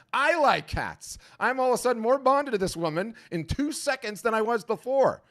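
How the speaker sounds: high put-on voice of a buyer instantly won over; gushing cat lover. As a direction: squeal it like a delighted fan.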